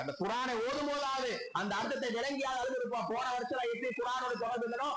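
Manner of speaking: high-pitched, sustained sermon appeal; impassioned preaching. Real, not acted. Preacher asks congregation if the Quran moves them, impassioned.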